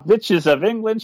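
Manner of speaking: lightly put-on witch accent, slow; a touch of the Grand High Witch's foreign accent; mock witch accent. Quoting the villain's line in a mock witch accent. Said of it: "she says them in a fun accent".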